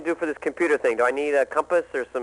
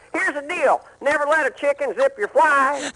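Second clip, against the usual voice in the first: a higher, folksy Texan twang; Ross Perot impression; 8 semitones higher than usual. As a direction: toss off homespun advice like a Texas billionaire. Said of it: "you're really not Ross Perot".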